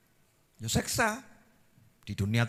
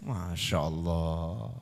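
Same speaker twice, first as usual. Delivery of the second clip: awed sigh; 12 semitones lower, 9 dB quieter, much slower than usual. Real, not acted. Preacher marvels softly.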